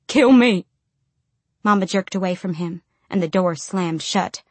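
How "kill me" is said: higher, louder burst of begging; desperate cry. A narrator's reading. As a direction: cry out the plea. Man's begging breaks into a cry.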